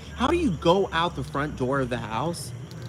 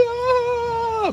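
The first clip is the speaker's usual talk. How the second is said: mock wail; 5 dB louder than usual. Dramatic mom wail.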